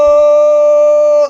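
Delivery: long, drawn-out booming cry with echo; echo effect. Pretend. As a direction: stretch the shout slowly and let it echo. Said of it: "That crazy echo effect on your voice".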